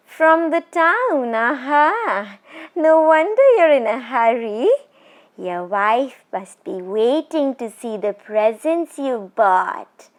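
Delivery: high, slow, eerie mockery fading to a whisper; a witch savouring his fear; mocking ghost woman. Ghost woman mocking the traveller about presents for his wife, eerie and slow.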